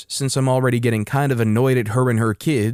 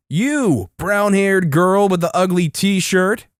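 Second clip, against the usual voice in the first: higher-pitched mock hillbilly holler; hillbilly mating call; 6 semitones higher, slower than usual. Entitled mother calling out a brown-haired girl, hillbilly call. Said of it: "in a hillbilly mating call".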